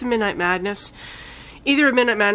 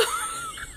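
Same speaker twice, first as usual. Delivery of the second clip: high squeaky laugh; 13 dB quieter than usual. Real, not acted. Laughs about the film.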